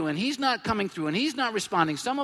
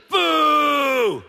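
long, loud boo; stadium boo; 7 semitones higher, 9 dB louder than usual. Retold or imitated. Lions fans leaving the stadium, booing loudly. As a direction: boo the losing team. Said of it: "and how do you leave?".

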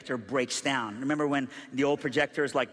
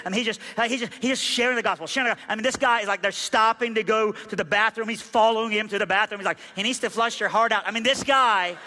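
high, driving storyteller delivery; worked-up preaching; 7 semitones higher, 5 dB louder than usual. Real, not acted. Preacher telling the crowd about a relentless evangelist, worked up. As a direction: build exasperation at a relentless man.

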